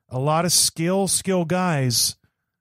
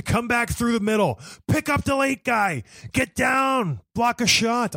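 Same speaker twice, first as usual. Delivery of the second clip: Schwarzenegger; 7 semitones higher than usual. Retold or imitated. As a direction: yell it like an action-movie drill sergeant. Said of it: "I sound like, uh, Schwarzenegger right there".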